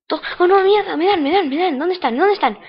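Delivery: high, loud alarmed yelling; panicked shouting. Real, not acted. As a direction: yell in sudden panic. Under fire in game, panicking.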